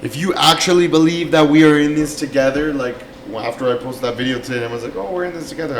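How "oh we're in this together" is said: mock sincere, dripping sarcasm; sarcastic. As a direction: say it like you don't mean it. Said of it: "I was being sarcastic".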